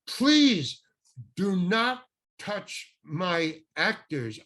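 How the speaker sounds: very slow, stern warning; slow scolding. Retold or imitated. Director scolding the agent, very slow and stern. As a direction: lay down the rule word by word.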